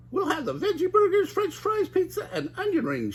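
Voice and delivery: high cartoon Shaggy ordering, half laughing; high excited teen ordering food. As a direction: rattle off the order eagerly. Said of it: "Shaggy said".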